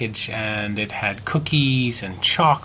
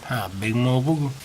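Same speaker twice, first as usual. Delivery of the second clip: ordinary delivery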